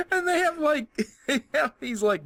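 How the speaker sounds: high-pitched, breaking up with laughter; real helpless laugh while starting a sentence; laughing through words. Real, not acted. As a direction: try to talk through real giggles. Cracking up at game locations.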